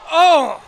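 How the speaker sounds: sudden high loud oh; loud gasp. Real, not acted. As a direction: burst out at the near miss. Near goal gasp.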